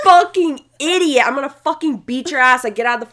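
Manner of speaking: re-yell how she screamed at the guy in the car; retold furious yelling. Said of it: "she instantly starts cussing him out".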